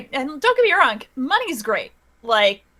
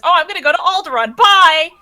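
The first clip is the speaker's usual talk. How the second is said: quick and higher, with a loud "Bye!"; breezy film character; 5 semitones higher, 8 dB louder than usual. Retold or imitated. Player retelling a film line to friends, mock breezy farewell. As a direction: imitate a chipper goodbye, rushed then called out. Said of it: "Someone is just like".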